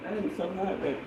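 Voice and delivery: ordinary talk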